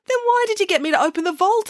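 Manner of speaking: high, plaintive question; bewildered bank manager. Acted. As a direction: ask in puzzled, high-pitched protest.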